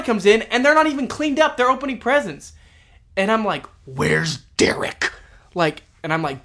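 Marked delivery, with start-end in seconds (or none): 3.9-5.1 slow, drawn-out retold question; exasperated demand. Party is running over and Derek is missing, drawn-out demand. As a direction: stretch both words out pointedly.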